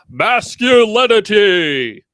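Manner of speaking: loud, hammed-up announcement; mock grandiose. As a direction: blare it like a ridiculous grand title. Mocking a section's grand title.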